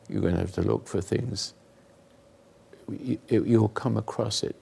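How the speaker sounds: normal talking voice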